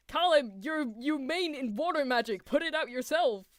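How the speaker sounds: high, loud, incredulous telling-off; exasperated scolding. Real, not acted. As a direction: tell them off for being useless. Scolding a character on screen.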